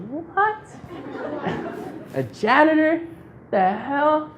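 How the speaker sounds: high disbelieving yelp breaking into laughter; incredulous shock. Retold or imitated. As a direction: react like you can't believe the offer, laughing. Hearing the janitor job offer.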